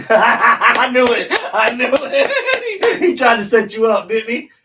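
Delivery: loud, high, laughing yell; real laughing glee. Real, not acted. Co-host teasing him about being set up, gleeful laughing yell.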